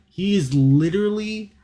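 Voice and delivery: loud, high, drawn-out disbelief; exasperated outburst. Real, not acted. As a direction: blurt it in shock. Listener reacting in disbelief.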